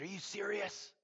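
can't believe what he hears; astonished. Retold or imitated. Clyde hearing the stranger came for Jesus, amazed. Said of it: "And he said".

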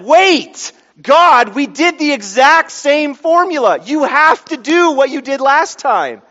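loud, high-pitched indignant complaint; hammed-up outraged demand to God; exasperated protest. Retells his protest to God.

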